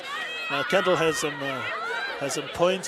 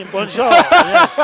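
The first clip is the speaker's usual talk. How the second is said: laughing; 8 semitones higher, 13 dB louder, slower than usual. Real, not acted. Laughing French greeting.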